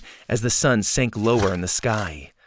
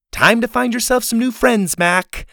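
taunting call; 10 semitones higher, 4 dB louder than usual. A narrator's reading. Laney storming off.

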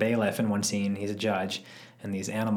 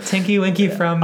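giggly; 8 semitones higher, 7 dB louder than usual. Real, not acted. Recalling Teletubbies, giggling.

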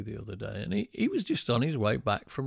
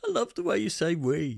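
laughing; 6 semitones higher, 3 dB louder than usual. Real, not acted. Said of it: "teasing, laughing".

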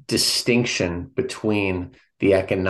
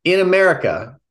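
lightly put-on radio reader, pitched up; 8 semitones higher, 4 dB louder than usual. Podcast host reading a passage aloud to listeners, mock radio voice. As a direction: read it out in a slightly raised radio voice. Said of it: "It's my radio voice".

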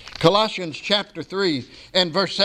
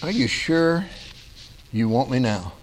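low, calm voice of God; 6 semitones lower than usual. Acted. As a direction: answer gently from above, lower. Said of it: "And God said".

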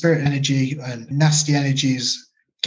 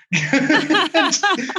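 real laugh. Real, not acted. Laughing at the memory.

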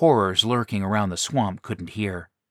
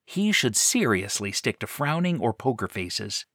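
ordinary delivery

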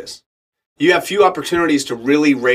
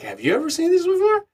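high, amazed question; stunned question; 4 dB quieter than usual. Retold or imitated. Actor asks his agent, high amazed question. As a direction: ask it in disbelief. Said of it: "I was like".

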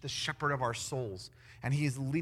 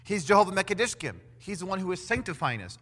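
ordinary talk, nothing marked

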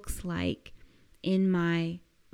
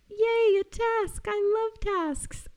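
much higher, bright mock delight; mock joyful cheer; 14 semitones higher than usual. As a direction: gush over the task like a kid with a treat. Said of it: "are you taking it on joyfully? Like".

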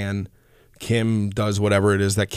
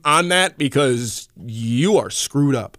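emphatic, higher-pitched mock rejection; mock refusal; 6 semitones higher, 3 dB louder than usual. Rehab staff refusing a hopeless patient, mock-emphatic refusal. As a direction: turn him down flat, playing it up.

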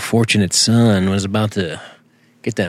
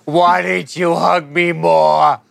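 higher, slow whining plea; mock whiny child; 9 semitones higher, 3 dB louder, slower than usual. Cohost mocking rage issues, slow whiny plea to dad. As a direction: whine it like a hurt kid to his dad.

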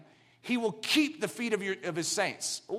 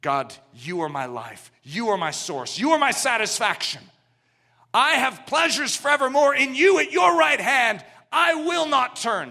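resolute prayer building; 3 semitones higher, 7 dB louder than usual. Retold or imitated. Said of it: "You say".

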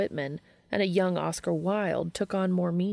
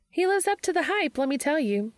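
gushing; 9 semitones higher than usual. Acted. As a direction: burst out with it, starstruck. Charlie gushing after the talk.

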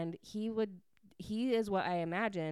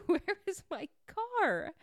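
high, loud, disbelieving cry; mock alarm; 12 semitones higher, 3 dB louder than usual. [name] finding her car gone, high loud mock alarm. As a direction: act out panicked disbelief, much higher in pitch.

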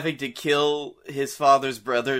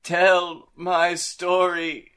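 dramatic Hamlet death line; 4 semitones higher, slower than usual. Pretend. Hamlet dying line parody.

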